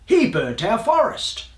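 high-pitched accusing protest; indignant cry. A narrator's reading. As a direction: cry out the blame. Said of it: "he cried".

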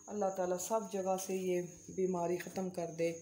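ordinary talk, nothing marked

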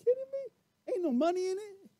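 high, incredulous imitation; mock exasperated wife. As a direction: squeak out the disbelief. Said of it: "she could have said".

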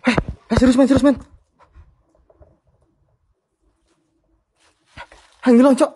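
startled cries as the figure disappears; panicked excitement. Real, not acted. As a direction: yelp it out, spooked and disbelieving. Something vanishes during ghost hunt.

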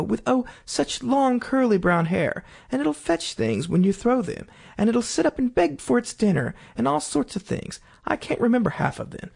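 high, eager chatter as Alice. A narrator's reading. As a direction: gush on about the dog. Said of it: "Alice went on eagerly".

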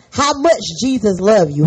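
ordinary talk, nothing marked

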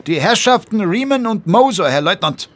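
high, loud sentry announcing guests; a young soldier calling out a formal report. Acted. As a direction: report the visitors crisply to the lieutenant. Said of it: "meldete der Posten".

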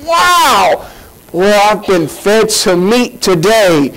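high, astonished exclamations; mock awed churchgoer, slow at the end; amazed listener. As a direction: gasp in wonder at the preaching. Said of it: "That kind of preacher that you're going".

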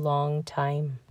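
ordinary delivery, nothing marked

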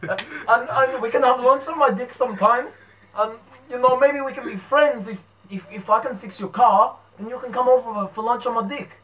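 cheeky mock New Zealand accent; Kiwi accent. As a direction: play up the Kiwi vowels. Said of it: "we're gonna Kiwi".

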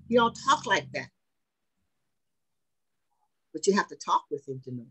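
ordinary talk, nothing marked

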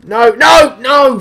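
high, loud panicked scream of no. Real, not acted. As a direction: yell it in sudden dismay. Killed in game, screaming.

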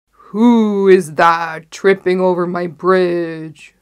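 very loud, slow troll roar. A narrator's reading. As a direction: bellow the challenge like a troll. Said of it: "roared the troll".

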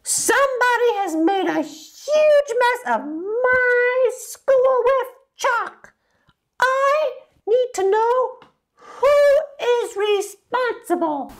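very high, slow, stern scolding; shrill angry principal. A narrator's reading. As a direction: scold like a furious headmistress.

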